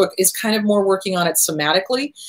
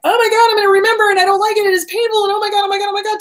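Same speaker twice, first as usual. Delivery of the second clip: very high, racing panic; hammed-up child panic, words tumbling out; freaked-out inner child; 12 semitones higher, 4 dB louder, faster than usual. Inner child dreading the memories, acted high-pitched freak-out. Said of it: "our inner child freaks out and goes".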